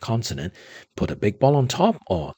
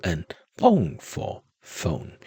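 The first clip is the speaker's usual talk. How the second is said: normal talking voice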